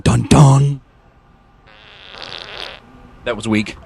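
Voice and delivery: ordinary delivery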